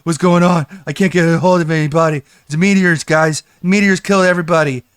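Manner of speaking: imitating a streamer freaking out, yelling; panicked freakout. Mocking the streamer left alone on stream, panicked yelling. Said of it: "he's like".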